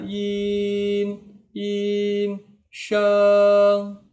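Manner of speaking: YIN, SHENG long, high and level; first tone. Shown as a demonstration. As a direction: hold YIN and SHENG on one high note. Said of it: "Ini nada pertama". (YIN, SHENG nada pertama, tinggi datar)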